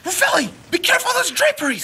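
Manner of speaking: high, loud cry of alarm; alarmed shout. Acted. To Ravelli as the drapes catch fire, alarmed shout. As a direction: yell a warning across the room.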